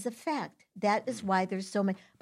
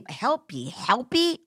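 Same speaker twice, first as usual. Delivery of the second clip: sing-song sneer at the word helpy; mock helpy voice; 2 semitones higher, slower than usual. Mocking the word help to the host, in a sing-song sneer. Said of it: "I don't help any".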